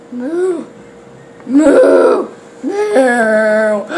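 playful cow moo; lightly voiced cow moo; mock moo. Imitating a cow.

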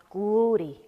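usual speaking voice

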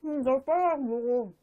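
chewing while talking; muffled through food; mouth full. Real, not acted. Seen 1: Lips pursed, cheeks puffed, chewing noodles just eaten; chopsticks in hand.

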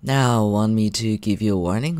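normal talking voice

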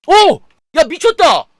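high, loud amazed cry; thrilled shout. Real, not acted. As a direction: shout in disbelief. Rare gacha drop.

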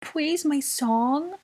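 put-on very high, drawn-out small-child plea; begging child. A narrator's reading. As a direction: beg like a little kid, stretching "please". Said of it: "Aurelian begged".

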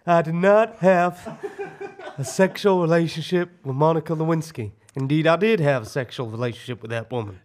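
deliberate, put-on American statesman; Bill Clinton. President denying then admitting an affair, measured American impersonation. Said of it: "Bill Clinton".

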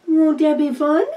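"Won't that be fun?" sung out high and loud; a bright singsong lift on "Won't that be fun?"; gushing up high. Acted. Cooing the question to the viewers, high and loud.

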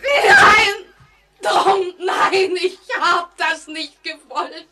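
high, loud, frantic screaming; shrieking denial at Tom; hysterical scream. Acted. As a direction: scream out in horror.

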